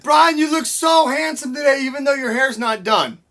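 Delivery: loud and high. Shown as a demonstration. Teacher leads a loud, teasing compliment to Brian. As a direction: call it out cheerfully. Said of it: "the next part said".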